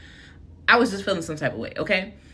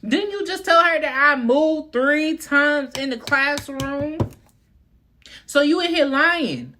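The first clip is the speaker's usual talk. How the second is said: higher, louder indignant disbelief; outraged incredulity; 9 semitones higher, 4 dB louder than usual. Retold or imitated. Student and mama confronting the lying teacher, louder indignant. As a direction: call out the liar in disbelief. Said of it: "me and my mama both look at her like".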